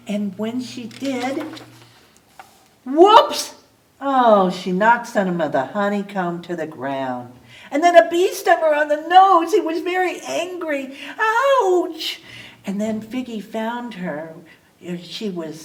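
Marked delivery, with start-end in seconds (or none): 2.8-4.5 high, loud startled whoops. Acted. Figgy loses her grip reaching for honey, high startled whoops. 11.2-12.2 high yelp of pain; ouch. Acted. Bee stings Figgy on the nose, high yelp.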